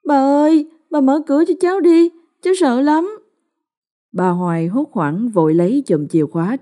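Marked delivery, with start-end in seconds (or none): 0.1-3.2 put-on high, tearful boy begging grandma, fast; a scared child on the verge of tears; boy whimpering to grandma, much higher. A narrator's reading. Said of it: "Thằng Minh mếu máo".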